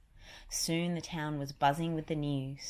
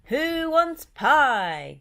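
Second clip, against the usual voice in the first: slow, high, much louder cartoon Goofy; 10 semitones higher, 11 dB louder, much slower than usual. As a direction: stretch it out like goofy Goofy. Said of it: "Goofy asked".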